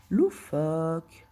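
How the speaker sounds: drawn out slowly. Real, not acted. Typing the word in, said slowly.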